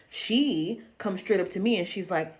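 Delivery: ordinary talk, nothing marked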